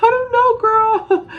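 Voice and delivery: very high, drawn-out nervous squeal; high-pitched squeal. Retold or imitated. Replaying her nervous reply to a friend, high squeal. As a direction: squeal it helplessly, stretching it out.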